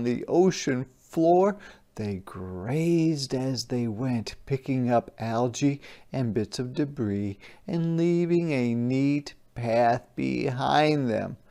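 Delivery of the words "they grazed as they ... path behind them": ordinary delivery, nothing marked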